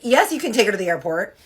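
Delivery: high, loud, gleeful retold yes; eager agreement. Handing off the hated airport drive to her ex, eager. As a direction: jump on the offer, hammed-up relief.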